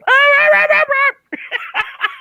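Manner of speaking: high yappy dog bark, squeaky and loud; yappy chihuahua voice. Acted. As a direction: yap like a tiny angry chihuahua. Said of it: "that was my impression of a, uh, chihuahua".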